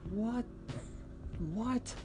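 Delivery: very high, soft, incredulous "what"; stunned disbelief. Retold or imitated. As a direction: squeak it out, taken aback. Reacts stunned to chef's confession.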